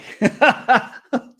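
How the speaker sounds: loud burst of high laughter; real laugh. Real, not acted. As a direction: laugh out at your own joke. Laughs at own sponsor joke.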